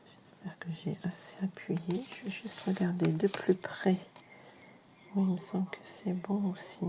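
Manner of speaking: murmure concentré en vérifiant de près; chuchoté. Real, not acted. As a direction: souffler les mots pour soi, absorbée. (chuchotement pour soi en inspectant le travail)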